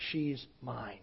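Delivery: low, quiet hint of the spirit's voice; demonic voice. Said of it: "his voice comes out and says".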